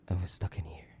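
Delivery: hushed resentful whisper; bitter whisper. Acted. Bitter aside in monologue.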